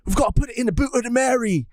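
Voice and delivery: put-on British accent, urgent and playful; British Sanji. Sanji urging Nami aboard the Merry, mock British accent. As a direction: keep up the mock British till it falls apart. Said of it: "I feel like my British is not too bad".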